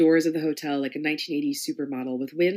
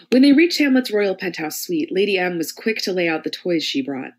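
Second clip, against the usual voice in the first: plain reading voice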